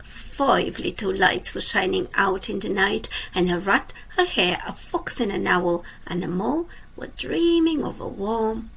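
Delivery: lively storybook reading for little ones; reading to children. A narrator's reading. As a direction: read it like a bedtime picture book. Reads a picture book aloud.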